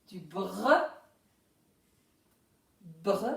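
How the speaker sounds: "br" as one blend with a short neutral vowel after it; sounded-out blend. Shown as a demonstration. Teacher breaks down brou for pupils, sounding out the blend. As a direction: isolate "br" slowly, rolling into the r. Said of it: "on a besoin du B".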